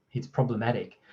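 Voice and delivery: normal talking voice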